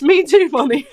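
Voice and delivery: laughing. Real, not acted. Laughing at own joke.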